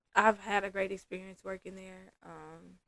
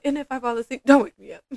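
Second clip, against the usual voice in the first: higher lilt, then a louder finish; light, joking warning; 4 semitones higher, 9 dB louder, faster than usual. Retold or imitated. Client playfully telling her therapist not to wake her, lightly. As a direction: imitate a client half-joking but meaning it. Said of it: "she was laughing when she said it".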